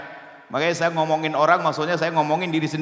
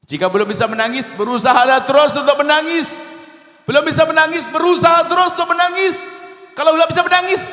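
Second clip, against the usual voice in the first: higher and louder with each repeat, pleading; building urgent exhortation; 11 semitones higher, 7 dB louder than usual. Shown as a demonstration. Preacher urges the congregation to keep trying to weep, rising to a passionate cry. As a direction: urge them harder every time. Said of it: "repeats Umar's advice in rising takes".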